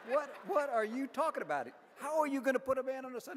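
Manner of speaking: Navy guy laughing in disbelief at the Air Force guy; incredulous scoff. Acted. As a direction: scoff like it's the dumbest idea ever.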